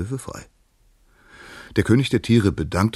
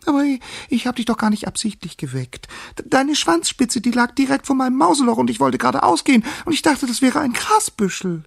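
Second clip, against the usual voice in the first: high, stammering, hurried squeak; squeaking mouse; 6 dB louder than usual. A narrator's reading. Mouse explaining to the lion why it woke him, squeaking. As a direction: squeak out a flustered excuse. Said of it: "piepste die Maus".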